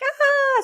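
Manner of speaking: high short shriek; a high, short mock scream. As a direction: let out a quick scream.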